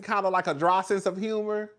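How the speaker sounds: ordinary talk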